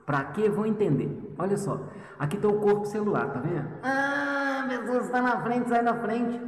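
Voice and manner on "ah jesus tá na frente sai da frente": high, exasperated whine; impatient student. Retold or imitated. Teacher voicing a student impatient with the slow lesson, much higher. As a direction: play a student shoving someone out of the way.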